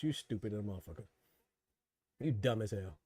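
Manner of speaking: low, soft put-down; muttered insult. Real, not acted. Streamer muttering at a viewer, low and soft. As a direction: drop it under your breath.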